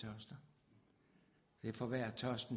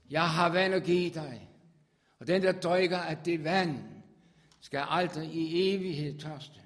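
loud, high preached proclamation; proclaiming; 4 semitones higher, 11 dB louder than usual. Acted. Preacher voicing Jesus offering water, loud and high. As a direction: ring it out over the congregation.

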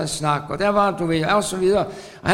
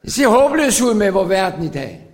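ordinary talk, nothing marked

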